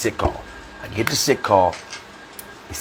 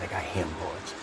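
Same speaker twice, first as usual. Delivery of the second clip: barely voiced, low and very soft; hushed confession; 5 semitones lower, 18 dB quieter than usual. Recruit confesses his ailment to a buddy, hushed. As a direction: admit it under your breath.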